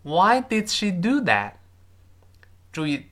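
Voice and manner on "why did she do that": slow, higher-pitched teacher's model sentence; measured demonstration, lifted pitch; slow model reading. Shown as a demonstration. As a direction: read it out slowly for learners to copy. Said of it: "再来一遍".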